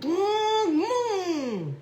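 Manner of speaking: high gurgling hum like underwater. Pretend. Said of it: "That's my underwater voice".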